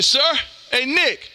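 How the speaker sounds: ordinary talk, nothing marked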